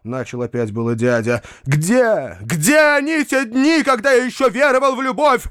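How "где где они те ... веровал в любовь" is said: high-pitched, melodramatic cry. A narrator's reading. Foma mourns his faith in love, crying out. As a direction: declaim the lament at the top of the range. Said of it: "кричал Фома".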